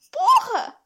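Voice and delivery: high, loud burst of disbelief; shocked exclamation. Real, not acted. Friend reacting to a 126-hanger video, shocked shout. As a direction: blurt it out, stunned.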